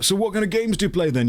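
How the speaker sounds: put-on high, pushy drunk questioning; drunk chap. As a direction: prod him like a tipsy stranger. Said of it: "he looked and he was like".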